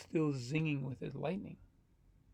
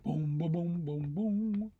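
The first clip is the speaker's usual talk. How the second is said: ominous hummed booms rising at the end; mock suspense sound effect; vocal drumroll booms; slower than usual. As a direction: boom out a suspense cue. Imitates dramatic story music.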